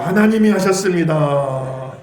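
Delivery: loud, slow, drawn-out declaration; call-and-response lead. Shown as a demonstration. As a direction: proclaim it slowly for the congregation to repeat. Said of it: "한 번 더 합시다". (따라 하도록 크고 느리게 선포)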